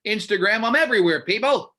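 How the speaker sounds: loud, high promo shout; excited announcement. Real, not acted. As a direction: proclaim it to the crowd. Announcing his social media.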